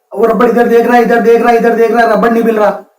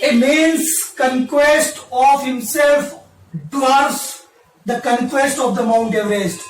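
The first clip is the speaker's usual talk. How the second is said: solemn quote recital; 8 dB quieter, much slower than usual. Acted. Recites an English quote slowly.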